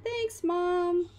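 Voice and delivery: lightly voiced kid thanking mom; a hint of a polite boy; son voice. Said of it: "He's like".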